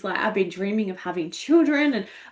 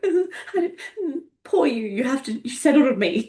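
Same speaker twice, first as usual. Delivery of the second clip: high-pitched, laughing pity voice; mock self-pity; 6 semitones higher than usual. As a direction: ham up the insecure inner voice with a laugh. Acts out her insecure emotions.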